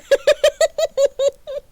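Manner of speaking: high burst of laughter; loud laugh. Real, not acted. Reader laughing at own voice crack, loud burst. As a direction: crack up loudly.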